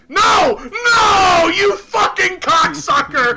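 loud, high howls of no into cursing; real explosive gamer rage; enraged shouting. Real, not acted. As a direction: scream no, then curse the opponent out. Outraged as opponent jumps in.

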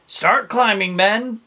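rally the troops firmly; commanding captain. A narrator's reading. Captain ordering men up the cliff, loud command. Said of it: "said the captain".

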